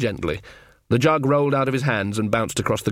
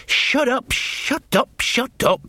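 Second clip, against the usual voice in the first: high, tense repeated shut up; a frightened young smith silencing his friends; nervous outburst; 14 semitones higher, much slower than usual. Acted. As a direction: snap it out in rising panic. Uneasy Jason snaps at the men.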